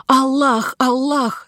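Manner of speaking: high, pleading cry of a scared merchant; frightened cry. A narrator's reading. Merchant cries out to God before the caliph, high and frightened. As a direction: wail it up high in panic. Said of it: "закричал тот".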